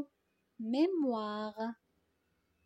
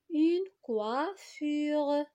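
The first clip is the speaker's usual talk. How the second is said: "coiffure" stretched out, higher pitch; slow completed word; 5 semitones higher, 4 dB louder, much slower than usual. Shown as a demonstration. Teacher gives pupils the completed noun, slow and drawn out. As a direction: draw out "Une coiffure" slowly and higher. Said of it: "les compléter par les syllabes".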